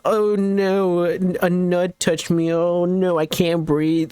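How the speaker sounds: high whiny mock panic; mock panicky victim. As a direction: ham up a helpless panic. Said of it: "they're like".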